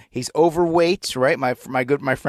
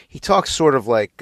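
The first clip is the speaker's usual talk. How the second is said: normal talking voice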